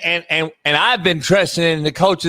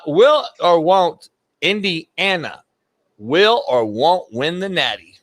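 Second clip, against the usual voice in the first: will or won't drawn out; slow, teasing game show ask; slow host question; slower than usual. Acted. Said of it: "game host asking".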